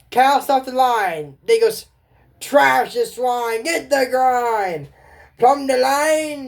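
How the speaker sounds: high, slow, loud sea commands; pirate crew commands. Acted. Pirate shouting sailing orders to the crew, slow and high. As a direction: bellow each order slowly.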